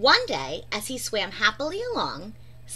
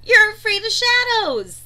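giggling; 10 semitones higher, 8 dB louder than usual. A narrator's reading. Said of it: "giggled Pufferfish".

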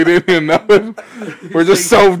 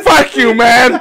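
higher-pitched joking outburst; mock indignant; 6 semitones higher, 3 dB louder, slower than usual. Snapping back at teasing friends, mock indignant. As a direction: burst out in playful protest.

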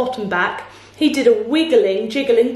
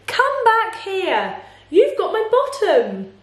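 high, drawn-out calling; storybook shout; 8 semitones higher, slower than usual. A narrator's reading. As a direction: call them back in a high voice. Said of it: "he shouted".

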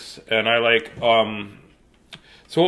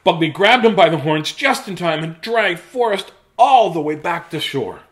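high, loud, excited storytelling; animated read-aloud climax; dramatic story narration; 5 semitones higher, 5 dB louder than usual. A narrator's reading. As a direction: race through the rescue, easing at the end.

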